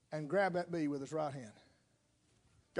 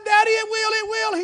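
high, loud, frightened cry; a panicked little girl sure the bee will get her; terrified child; 12 dB louder than usual. Retold or imitated. Girl panics in the car that the bee is loose, high and loud.

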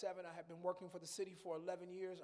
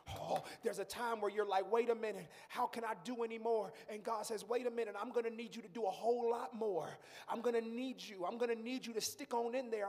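louder preacher cadence rising; building preaching; 3 semitones higher, 8 dB louder than usual. Acted. Pastor quoting God to the church, louder and building. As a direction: drive it home to the congregation.

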